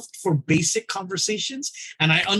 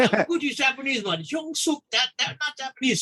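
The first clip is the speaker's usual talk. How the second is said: mock reasoning; 8 semitones higher than usual. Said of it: "It's like".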